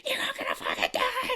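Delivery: tiny, very high voice down the line; mock frantic squawk from the receiver; Brenda. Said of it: "her on the other line".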